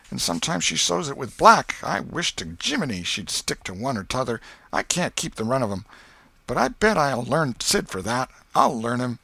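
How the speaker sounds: put-on a frustrated boy muttering revenge; boy fuming alone over the needle trick; Tom. A narrator's reading. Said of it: "He said".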